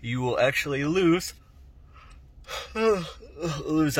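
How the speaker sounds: yawning mid-sentence, can't hold it back; talking through a yawn. Real, not acted. Vlogger to viewers, yawning mid-point. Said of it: "Uh, I keep yawning".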